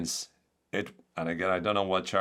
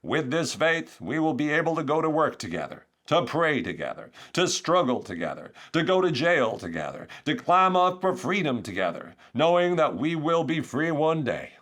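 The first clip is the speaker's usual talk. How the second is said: Martin Luther King; 8 semitones higher, 4 dB louder than usual. Acted. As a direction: build the refrain like a stirring orator. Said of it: "sorry for putting on his accent".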